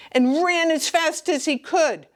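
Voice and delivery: high quick rush; excited. A narrator's reading. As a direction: speed it up with excitement. Exciting escape.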